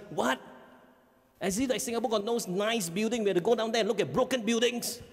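high, scornful scoff; scoffing teen. Retold or imitated. Son scoffing to his dad about Rome's buildings. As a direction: sneer at the old ruins.

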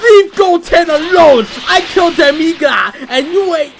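high, loud battle yell at the enemy; raging at the enemy who hit Goten; furious yelling. Acted. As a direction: scream it at the foe in full fury. Fighting game, yelling at enemy.

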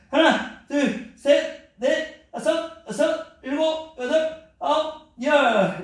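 counting high and loud under strain; doing duck kicks. Real, not acted. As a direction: count while working hard. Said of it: "힘들어".

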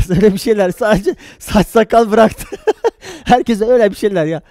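talking through loud laughter; laughing. Real, not acted. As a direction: crack up while trying to explain. Laughing at own mnemonic joke.

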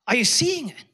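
ordinary talk

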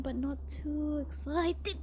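drawn out, bursting into stutter and laugh; fail to contain the excitement on EXCITED; overexcited. Shown as a demonstration. Streamer seeks an in-between of excitement, bubbling over. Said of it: "find a good in-between".

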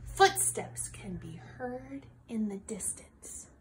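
suspenseful whisper. A narrator's reading. To the children as the farmer approaches, whispered. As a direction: breathe it out with no voice, building suspense.

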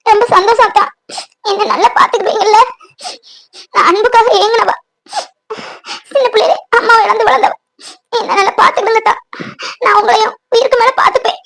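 overwhelmed with grateful tears; weeping with joy to her fiancée; tearful happy crying. Acted. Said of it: "மொத அழுகை நிப்பாட்டு".

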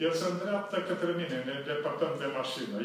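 usual speaking voice

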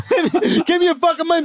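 high, laughing mock holdup demand; mock robber. Gunman to his moaning victim, high laughing mock demand. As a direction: demand it frantically through laughter. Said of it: "he's like".

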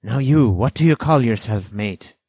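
loud, high castaway's question; eager demand. A narrator's reading. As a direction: pipe up loudly, demanding to know. Castaway eagerly demands boy's name.

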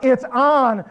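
high, loud comic alarm; heightened storytelling voice; retold panic. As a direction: blurt it in mock panic. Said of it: "I was scared".

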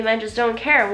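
ordinary delivery

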